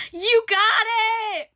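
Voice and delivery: high, loud joyful shout; excited shout. Retold or imitated. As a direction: yell the good news. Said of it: "she's like".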